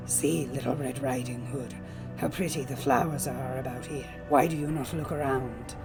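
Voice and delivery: low, soft wolf voice; sly, quiet persuasion from a wolf; coaxing wolf. A narrator's reading. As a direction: tempt her gently, hushed and low. Wolf coaxes the girl.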